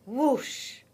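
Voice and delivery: a quick rush of air; sudden splashy whoosh; whoosh of a lunge. A narrator's reading. Coyote lunges into water.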